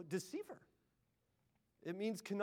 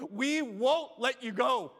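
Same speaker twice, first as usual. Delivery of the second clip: slow, louder, building vow; louder desperate vow; 5 semitones higher, 8 dB louder, much slower than usual. Acted. Preacher leading the church's vow, louder and slow. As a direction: push the vow louder, refusing to let go. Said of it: "a Jacob-like desperation".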